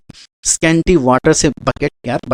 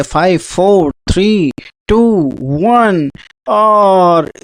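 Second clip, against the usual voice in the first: drawn-out, higher countdown to the buzzer; quiz countdown; 4 semitones higher, much slower than usual. Acted. Quiz teacher counting down the timer for viewers, drawn out. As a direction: count the seconds down slowly like a game host.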